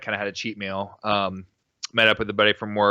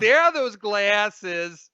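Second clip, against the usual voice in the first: much higher, slow medium voice; Long Island Medium; 9 semitones higher, much slower than usual. Retold or imitated. Man mimicking girlfriend's medium-like line, high and slow. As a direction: croon it like a TV psychic. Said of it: "the Long Island Medium".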